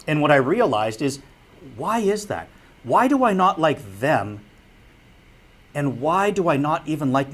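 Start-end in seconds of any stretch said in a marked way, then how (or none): none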